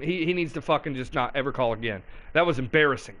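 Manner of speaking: ordinary talk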